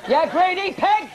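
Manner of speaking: high, slow hammed-up jibe through laughter; mock husband insult. Singer mocks husband insulting wife years later, laughing. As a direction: bark it like a fed-up husband, laughing. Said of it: "the animals get bigger".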